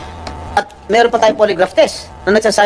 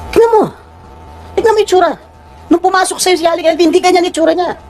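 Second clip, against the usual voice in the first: real indignant outburst, high-pitched; raised voice; 8 semitones higher than usual. Real, not acted. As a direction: demand they look, voice shooting up. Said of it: "tumataas ang boses ko".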